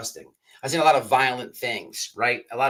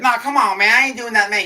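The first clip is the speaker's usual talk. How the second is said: put-on loud, high country-accent protest; big country accent; 10 semitones higher, 10 dB louder than usual. Inmate refusing his buddy's dare, protesting in a country accent. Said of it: "he had this big old country accent".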